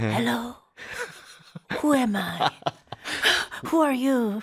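mock-confused caller, half laughing; hammed-up confusion with long pauses; dazed forgetful caller. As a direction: play lost and bewildered after endless hold. Acting out caller who forgot everything.

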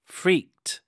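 "freaked" said as one syllable, the ed a crisp final t; teacher isolates "freaked" for learners, crisp final t. Shown as a demonstration. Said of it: "ed 붙여야 되니까 freaked".

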